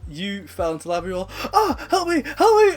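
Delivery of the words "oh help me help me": high, loud squeals for help; mock panicked cry. Friend burning in lava begs, mock panicked cry. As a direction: imitate a friend crying out desperately.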